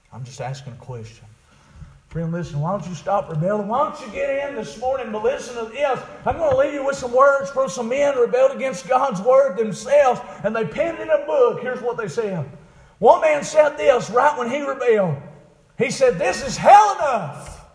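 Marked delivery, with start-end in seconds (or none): 2.1-16.2 high, loud revival preacher; impassioned preaching. Acted. Preaching at full force. 16.2-17.7 loud, slow cry rising high; preached dying cry. Retold or imitated. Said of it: "That's what he cried".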